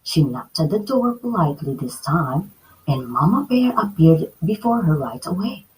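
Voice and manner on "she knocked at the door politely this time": usual speaking voice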